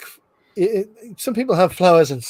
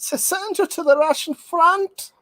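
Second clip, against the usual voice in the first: high hammed-up German officer line; high mock German officer; 12 semitones higher than usual. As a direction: threaten like a comic German officer. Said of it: "Werner the Ferret".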